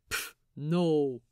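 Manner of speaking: dismissive "Pff, no," dropped low; scoff. Acted. Wee John dismissing the Swede, scoffing. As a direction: scoff at the idea. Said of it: "Wee John scoffs".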